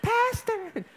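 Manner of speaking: hammed-up flirt calling pastor; mock seductive woman. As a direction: coo it through a laugh. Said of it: "imagine it did".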